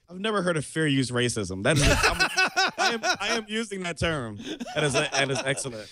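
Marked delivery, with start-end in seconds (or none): 1.6-5.9 helpless laughter breaking into the words; laughing while talking. Real, not acted. Co-host cracking up at the phrase fair use racism, laughing through it. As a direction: barely get it out through real laughter.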